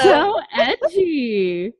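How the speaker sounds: high, long drawn-out gushing tease; teasing. Real, not acted. Friend reacting to an edgy picture, teasing. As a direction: stretch both words high, with a laugh.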